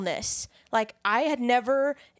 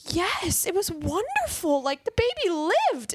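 elated; 10 semitones higher than usual. Retold or imitated. Retelling her own elated reply.

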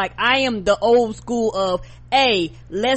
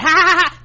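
loud, high mock cackle; ha-ha-ha mimicking the crowd; mocking laugh; 8 semitones higher, 8 dB louder, much faster than usual. Mock laugh at filmed drunk girl.

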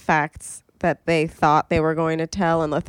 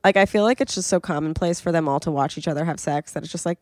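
normal talking voice

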